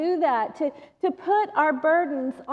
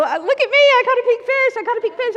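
high, loud joyful yell; thrilled bragging yell; excited yelling; 8 semitones higher, 7 dB louder, faster than usual. Retold or imitated. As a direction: shout your catch to everyone. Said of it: "like, yelling".

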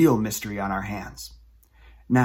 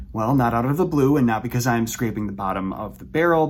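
normal talking voice

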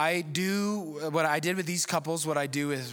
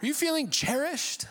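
mock sappy husband; 4 semitones higher than usual. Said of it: "I rarely look at my wife and go".

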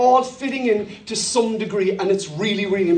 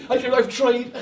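usual speaking voice

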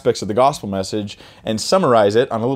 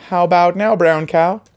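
playful teasing; 7 semitones higher, 4 dB louder than usual. Acted. Playful rhyme quiz.

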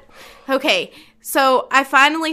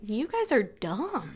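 silent inner scorn; 4 semitones lower, 12 dB quieter, slower than usual. Retold or imitated. Inner thought about dumb guests.

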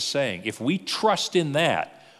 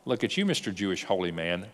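sarcastic retort; 6 semitones lower, 6 dB quieter than usual. Retold or imitated. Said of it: "offended and go".

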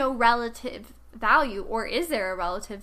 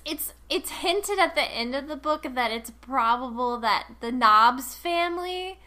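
ordinary delivery

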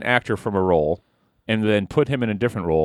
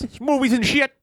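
high goofy dismissive voice; mock it in a silly high voice; 13 semitones higher than usual. Mocking the topic intro.

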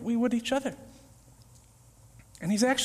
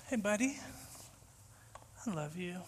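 much softer, low and gentle; tender reassurance; 3 semitones lower, 13 dB quieter than usual. Retold or imitated. Jesus calming the anxious believer, soft and tender. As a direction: speak it like a loving friend.

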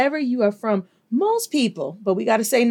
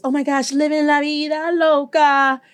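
put-on higher, giddy party-girl gush; mock party girl; 6 semitones higher than usual. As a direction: gush like a carefree partier. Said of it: "I know some people have this".

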